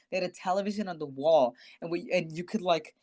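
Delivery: usual speaking voice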